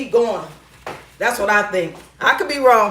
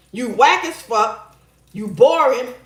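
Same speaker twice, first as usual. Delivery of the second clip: ordinary talk, nothing marked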